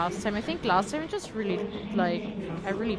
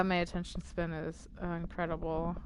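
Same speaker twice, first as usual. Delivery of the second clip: ordinary talk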